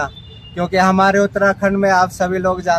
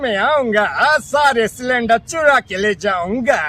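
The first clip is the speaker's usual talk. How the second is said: higher, slow cartoon voice; cartoon character voice; 4 semitones higher, slower than usual. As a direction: taunt like a cartoon villain. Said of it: "Motu Patlu ... झलकियां".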